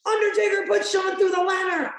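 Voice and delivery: high, sustained imitated announcer shout; Michael Cole yell. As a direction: cry it out like a ringside commentator. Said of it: "Michael Cole went".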